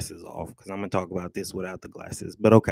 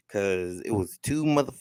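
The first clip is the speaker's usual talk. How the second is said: ordinary talk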